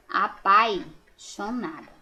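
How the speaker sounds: slow "apaixonada" with the i glide; correct spelling. Shown as a demonstration. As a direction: say "apaixonada" clearly, "a-pai-xo-na-da". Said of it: "colocando o izinho aqui".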